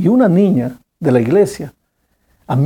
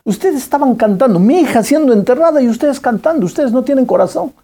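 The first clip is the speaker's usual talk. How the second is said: raised, higher bitter accusation; grieving father lashing out at the singing girl; aggressive reproach; 8 semitones higher than usual. Retold or imitated. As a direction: hurl the blame at the girl. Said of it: "le dijo a la chica agresivamente".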